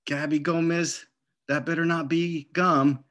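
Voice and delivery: mother calling out a stern warning; scolding mom. Acted. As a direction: warn her like an angry mother.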